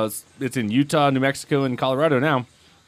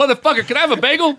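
mock rude demand; 13 semitones higher, faster than usual.

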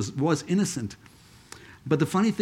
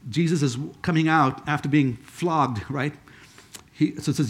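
ordinary talk

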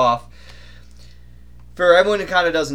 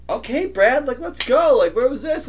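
much higher, amazed ribbing; impressed surprise; 10 semitones higher than usual. Retold or imitated. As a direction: tease him with surprise. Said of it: "Jenny was like".

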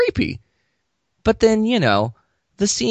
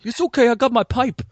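high-pitched mock reassurance; mock movie character; 11 semitones higher than usual. Movie character talking through a pipe, in a silly high voice. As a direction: put on a silly high voice, well above your own.

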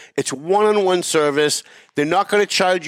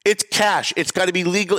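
ordinary talk, nothing marked

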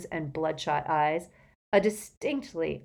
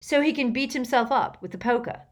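higher, scornful retort; snorting; 7 semitones higher, 4 dB louder than usual. A narrator's reading. Harry refuses Hermione's plea, snorting. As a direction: throw it back with a touch of scorn. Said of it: "snorted Harry".

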